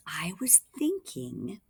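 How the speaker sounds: slow whispered thought; whispered musing. Acted. Jane musing to the puppets, whispered. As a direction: think aloud in a hush.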